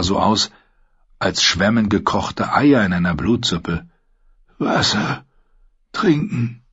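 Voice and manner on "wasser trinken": breathy, high, voiceless plea; barely any voice left, begging; a dying man rasping for water; faint begging. A narrator's reading. Dying prisoner begs water.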